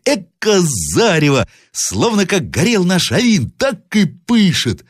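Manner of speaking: put-on high, drawn-out child's wonder; boy exclaiming. A narrator's reading. Grandson marvels at the fiery glow, excited cry. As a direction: cry out amazed like a little boy. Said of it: "закричал мальчик".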